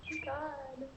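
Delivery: teary. Real, not acted. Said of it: "wipe the tears that are coming out".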